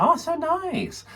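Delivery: very high, drawn-out coo; mock gushing. Friend admiring handmade chocolates, high gushing. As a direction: gush slowly in a high imitation.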